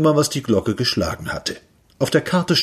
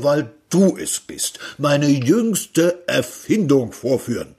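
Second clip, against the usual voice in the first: higher, proud scientist unveiling his invention; eccentric inventor; 5 semitones higher than usual. Acted. Inventor showing Peter his time brake, proud and lofty. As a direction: present the invention with grand pride.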